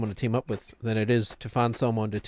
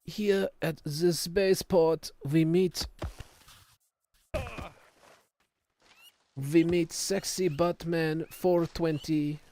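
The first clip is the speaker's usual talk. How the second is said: slow, higher, solemn German documentary narrator; Werner Herzog impression; 6 semitones higher, much slower than usual. Streamer narrates his game partner as Werner Herzog, slow and solemn. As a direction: intone it slowly like a grave German filmmaker. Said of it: "I have a pretty decent Werner Herzog impression".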